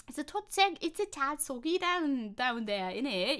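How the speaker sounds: light put-on British accent; jokey British accent. Streamer describing rainy weather to chat in a mock British accent. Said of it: "we wanted to do this all in British accent".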